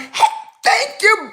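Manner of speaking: normal talking voice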